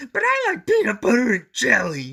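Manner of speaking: high, loud crying monster wail; Grover wailing. A narrator's reading. Grover wailing to Betty Lou about his sandwich, high and crying. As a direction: wail it tearfully. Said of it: "he wailed".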